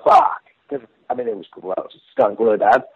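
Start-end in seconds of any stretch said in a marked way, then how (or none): 0.0-0.4 retold yelp of disgust. Band reacting to stink bombs.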